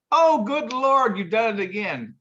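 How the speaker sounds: high, slow mock exclaim; priest voice. Priest caught by cop, high slow mock dismay. Priest retold punchline again.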